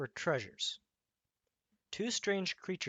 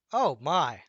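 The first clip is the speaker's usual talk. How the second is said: loud, astonished gasp of words; surprised exclaim; 11 dB louder than usual. Acted. Rabbit reacts to Old Horse's words, loudly astonished. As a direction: blurt it out in amazement. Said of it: "exclaimed the rabbit".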